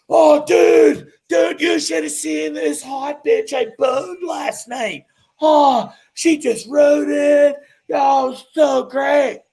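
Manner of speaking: hammed-up dudebro shouting his boast; mocking bro brag. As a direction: ham up the boastful buddy, stretching every word. Buddy bragging, voiced mockingly.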